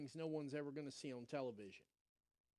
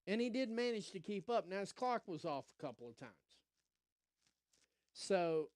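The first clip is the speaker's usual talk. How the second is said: ordinary talk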